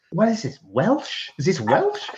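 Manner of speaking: mock confusion at the accent; a hammed-up puzzled filmmaker. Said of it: "and he goes".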